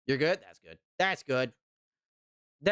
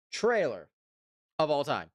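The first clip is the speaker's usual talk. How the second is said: movie trailer announcer; 4 semitones higher, slower than usual. Pretend.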